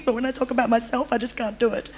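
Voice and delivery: softly, fighting back real tears; tearful. Real, not acted. Apologising for crying while talking about herself, tearful. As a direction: voice sinking away as tears come. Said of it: "sorry, I cry a lot".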